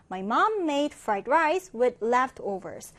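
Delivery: very slow, clear reading of the English sentence; slow, careful classroom read-aloud; slow model reading. Shown as a demonstration. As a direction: read it slowly for learners to follow. Teacher reads model sentence.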